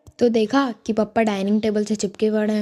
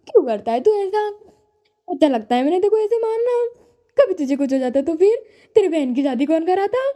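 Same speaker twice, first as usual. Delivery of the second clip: crying mom after scolding; 7 semitones higher than usual. Retold or imitated. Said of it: "खुद ही sad होके रोएंगी".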